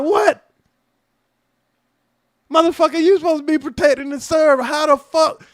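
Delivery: high, loud outraged disbelief; outraged yell. Retold or imitated. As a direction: yell it like you can't believe the accusation. Outraged reply to police accusation.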